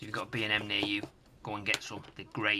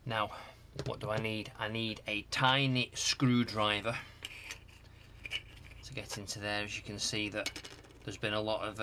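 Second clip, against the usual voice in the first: ordinary talk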